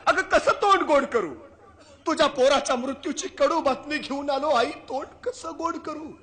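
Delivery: king crying out loud and high with grief, slowing at the end; a king who cannot bring himself to break the bad news; anguished king. (दुःखी राजाचा मोठा, उंच आवाज)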